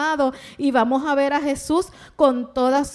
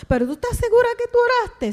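put-on high, fast child's voice; pleading child; 11 semitones higher, much faster than usual. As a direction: ask it quickly as a desperate little boy. Boy presses his mother, retold. (niño insistente, voz aguda y rápida)

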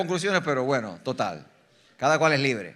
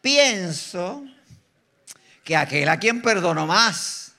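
slow, measured mock answer; a hint of a pompous hypocrite; lightly voiced hypocrite; much slower than usual. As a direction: draw out the reluctant reply. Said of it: "hipócrita".

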